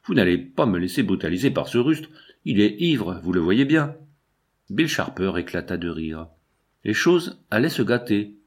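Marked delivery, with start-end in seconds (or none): none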